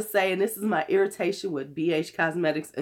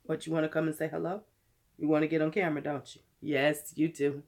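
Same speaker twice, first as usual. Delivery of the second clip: talking to a pet; 5 semitones lower, 7 dB quieter than usual. Real, not acted. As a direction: coo at the pet gently. Baby talk to a pet.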